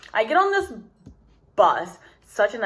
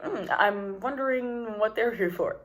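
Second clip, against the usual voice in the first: mock innocent musing; 2 semitones higher, 6 dB quieter than usual. Feigned innocent wondering.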